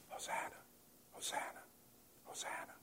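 Hosanna whispered three times, barely audible; mock a half-hearted crowd with a whisper; timid whisper. Shown as a demonstration. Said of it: "It's not like".

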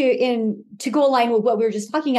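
ordinary talk, nothing marked

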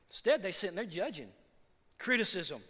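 ordinary talk, nothing marked

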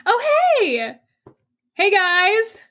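bubbly chatty dishwasher, upbeat; cheerful NPC. Acted. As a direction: play the friendly chatterbox coworker. GM voices cheerful NPC Kyle.

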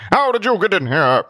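angry shout. Acted. Zealot shakes intruder.

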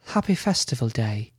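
light female character voice; woman's voice. Acted. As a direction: answer him in a gentler woman's voice. Ella replies in her voice.

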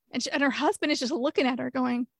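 ordinary talk

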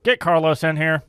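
loud shout to fetch someone; calling out. Acted. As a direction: call sharply into the next room. Calls Carlos in from outside.

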